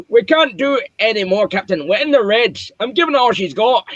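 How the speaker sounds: high-pitched, strained Scottish starship engineer; Scottish accent. Acted. Engineer warning the captain they're in the red, Scottish accent. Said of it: "That's my best Scottish accent".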